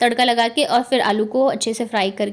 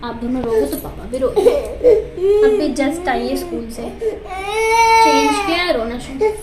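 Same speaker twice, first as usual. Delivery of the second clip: higher, louder soothing over the wails; soothing over crying; 10 semitones higher, 5 dB louder than usual. Real, not acted. Mother to her wailing little one, raised and soothing.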